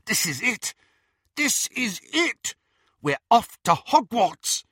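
high, mounting excitement; exhilarated. A narrator's reading. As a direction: build the thrill to a loud finish. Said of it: "unable to suppress the exhilaration in his voice".

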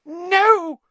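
very high, loud squeal; terrified cry. A narrator's reading. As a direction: shriek it in sheer panic. Pettigrew falls to knees, terrified.

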